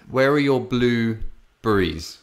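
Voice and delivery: "blueberries" drawn out slowly, each part separated; deliberately enunciated. Retold or imitated. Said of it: "how can I say it differently".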